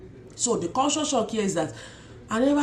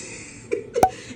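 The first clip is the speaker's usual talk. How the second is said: laughing. Real, not acted. Laughing at own joke.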